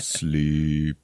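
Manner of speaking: low, drawn-out subliminal broadcast; droning hypnotic voice. Retold or imitated. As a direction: drone it out like a hidden command. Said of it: "this voice going".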